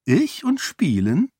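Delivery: high, slow, mock-innocent question; exaggerated coy disbelief; coy innocence. A narrator's reading. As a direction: feign innocence with swooping pitch. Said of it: "fragte Sarene mit klimpernden Wimpern".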